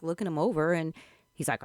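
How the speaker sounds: usual speaking voice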